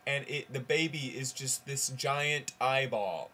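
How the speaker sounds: ordinary talk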